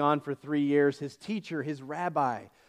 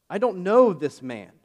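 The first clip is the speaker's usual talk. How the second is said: denial with an oath; 5 semitones higher, 7 dB louder than usual. Retold or imitated. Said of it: "with an oath".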